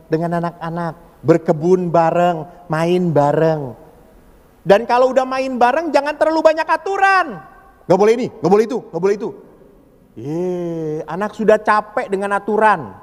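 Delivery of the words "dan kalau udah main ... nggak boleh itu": higher, mocking list of rules; a preacher mocking nagging parents; mock strict parent. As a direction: rattle off the parent's bans mockingly.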